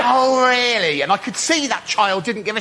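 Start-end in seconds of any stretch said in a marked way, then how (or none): 0.0-1.0 mock fury. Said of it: "I was furious".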